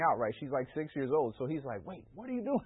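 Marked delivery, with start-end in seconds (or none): 1.9-2.6 imitating a startled father, voice jumping high; father calling after his daughter in alarm; alarmed dad. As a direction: retell the dad's sudden panic, pitched up. Father sees daughter leave car.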